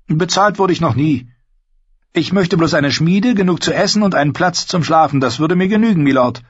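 put-on high, eager boy's voice; eager young Gendry. A narrator's reading.

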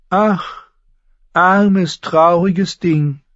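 high, loud and slow pitying sigh. A narrator's reading. Varys pities Sansa before the court, sighing. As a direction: sigh over the poor child. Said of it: "seufzte Varys".

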